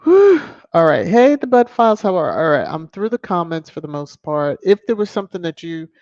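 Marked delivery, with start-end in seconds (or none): 0.0-0.4 a big breathless whew after laughing; loud high whew. Real, not acted. Host recovers from laughter with a loud high whew.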